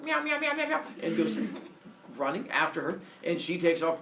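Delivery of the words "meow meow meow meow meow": rapid-fire high meows; giant snake meowing. Acted. Giant python chasing Leto, meowing. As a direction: chatter meows as a silly monster. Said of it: "it's like".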